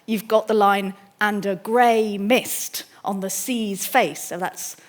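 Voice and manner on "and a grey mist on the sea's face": "And a" light, "grey mist" slow and both stressed; pyrrhic then spondee. Shown as a demonstration. Lecturer showing alternating feet, two unstressed then two stressed, slowly. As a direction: read slowly, landing evenly on "grey mist" and "sea's face". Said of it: "two unstressed, a pyrrhic, uh, foot, and then a spondy".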